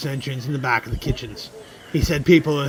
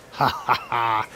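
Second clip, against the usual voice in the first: hearty laugh; 3 semitones lower, slower than usual. Acted.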